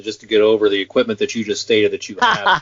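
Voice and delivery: normal talking voice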